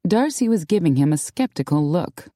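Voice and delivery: usual speaking voice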